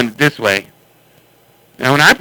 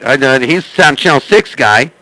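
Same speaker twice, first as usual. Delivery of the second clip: usual speaking voice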